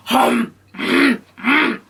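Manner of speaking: mock greedy chomping; exaggerated wordless munching and grunting; disgusting munching noises. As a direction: imitate someone eating noisily. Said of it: "the way he's eating is so disgusting".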